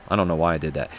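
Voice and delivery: ordinary talk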